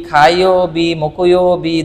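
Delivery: ordinary talk, nothing marked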